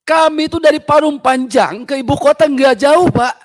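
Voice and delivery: high, loud complaint; heated, insistent plea; angry protest. Real, not acted.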